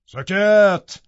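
barked command. Acted. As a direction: cut her off with a shout. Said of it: "هیتکلیف پس فطرت گفت".

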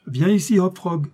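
a touch of royal command; lightly voiced king. A narrator's reading. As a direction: call the jester over as the king. King summons his jester.

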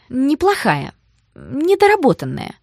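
high-pitched, deliberate correction; pointed correction. Acted. Sister disputes that the plan was bad, high and pointed. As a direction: correct her as if making a point.

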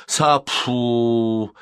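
menacing sleep-talk exclamation; threatening cry. A narrator's reading. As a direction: show how you will deal with them. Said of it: "ясно показывая, как он с этим разделается".

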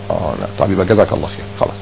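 soft, low, satisfied close; relieved acceptance. Retold or imitated. A friend accepting the explanation, soft and low. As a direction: let it go quietly.

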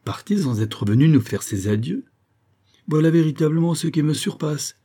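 indignant, mildly incredulous; astonished exclamation. A narrator's reading. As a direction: protest in disbelief at the news. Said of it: "s'écria Soriol". (s'exclamer avec indignation)

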